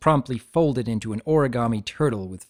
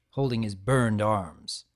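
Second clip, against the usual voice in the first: plain reading voice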